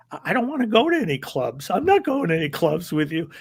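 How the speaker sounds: a thin nervous guy backing away from a big wrestler; nervous geeky guy. Retold or imitated. Geeky stranger refusing the wrestler, a high, nervous voice.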